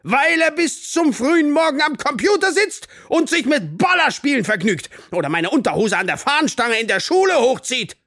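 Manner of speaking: high, loud, rapid rant; furious accusation. A narrator's reading. Teacher accuses pupil of gaming all night, furiously. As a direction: rage through the list of offences.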